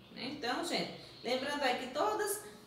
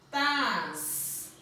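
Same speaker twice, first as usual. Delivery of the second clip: "tas" as a separate, stretched syllable; isolated, drawn-out final syllable "tas". Shown as a demonstration. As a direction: sound out "tas" alone after a pause. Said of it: "Como é que eu falo essa palavra?".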